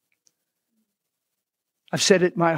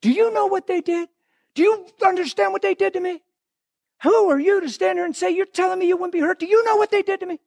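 mock indignant protest; 13 semitones higher than usual. Acting hurt churchgoer's protest.

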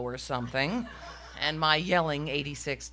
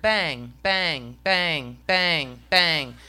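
repeated banging; 5 dB louder, much slower than usual. Retold or imitated. Imitates seat banging on toilet.

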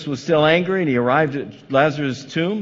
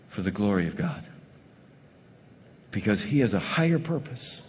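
hushed preaching; 4 semitones lower, 6 dB quieter than usual. Acted. Quiet sermon close.

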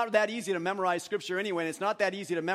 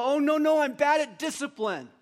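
loud, high-pitched objection; mock protest; 7 semitones higher, 6 dB louder than usual. Pastor voicing congregants who balk at discipline, mock protest.

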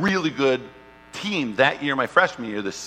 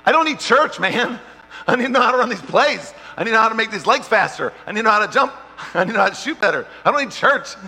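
loud, high, cocky refusal; brash protest; 9 semitones higher, 6 dB louder, faster than usual. Retold or imitated. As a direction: wave off church, all swagger. Retold brash refusal of church.

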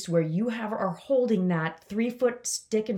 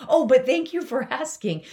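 higher, louder mock-polite thanks; hammed-up polite thank-you; polite afterthought; 7 semitones higher, 6 dB louder than usual. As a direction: overdo the gracious save. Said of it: "I had to say".

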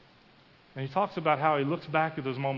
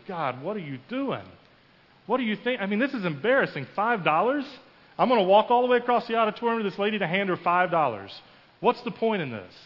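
loud, higher-pitched indignant complaint; exasperated protest; 5 semitones higher, 4 dB louder than usual. Retold or imitated. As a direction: protest in embarrassed disbelief. Protests to God over five dollars.

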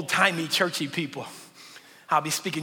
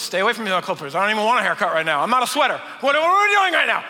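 panicky sheep; 5 dB louder, much faster than usual. Acted. Sheep waking up at night to imagined threats, panicky. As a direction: play a jumpy sheep spooked by every noise. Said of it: "I don't keep waking up going".

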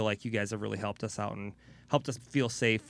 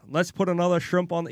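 imitating an Australian accent, higher and a bit louder; 7 semitones higher, 4 dB louder than usual. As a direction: toss off a mock Australian line. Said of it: "Australia, you know, you gotta".

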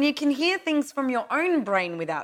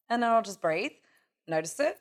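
usual speaking voice

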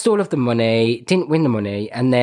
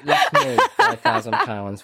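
laughing; 9 semitones higher than usual. Real, not acted. Laughing protest.

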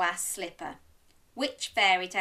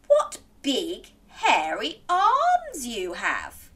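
slow, spaced-out wonder; said carefully; 3 semitones higher, 5 dB louder, slower than usual. Acted. Little Red eyeing Granny's arms, slow and careful. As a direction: pick out each word slowly. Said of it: "Little Red said carefully".

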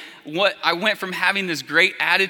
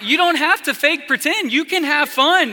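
laughing reassurance; 9 semitones higher than usual. Real, not acted. Mom laughing, reassuring her daughter.

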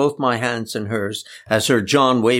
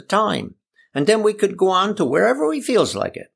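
put-on high-pitched old friend with country dialect; lilting, high dialect voice for an eager planner; Uncle Eli; 9 semitones higher than usual. Old friend planning the cruise, high dialect voice.

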